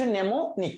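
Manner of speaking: mnemonic said as nemonik, the first m silent. Shown as a demonstration. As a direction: start mnemonic on the n sound, no m. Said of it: "এমটার উচ্চারণ হয় না, এমটা silent থাকে".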